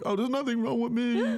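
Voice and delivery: high-pitched put-on "I'm okay" voice; exaggerated high-voiced denial; mock brave denial. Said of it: "it's like".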